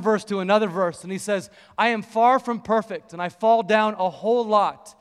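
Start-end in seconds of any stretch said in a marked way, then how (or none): 1.8-4.9 louder, higher preaching; preacher mode. Retold or imitated. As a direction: testify with fire. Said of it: "in full preacher mode now".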